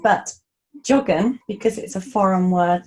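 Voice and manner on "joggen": joggen with the English j as in jog; English j sound. Shown as a demonstration. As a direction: keep the j of joggen as in English jump. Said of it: "they kind of tend to keep the j sound".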